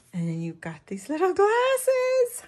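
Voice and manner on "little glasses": delighted squeal. Real, not acted. Crafter showing viewers little glasses, squealing with delight. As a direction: voice climbing high with glee.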